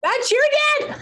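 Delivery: high-pitched amazed exclamation; astonished. Real, not acted. Medium learns the helper is client's dad, astonished. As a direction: shoot way up in pitch with surprise. Reader amazed that guess was right.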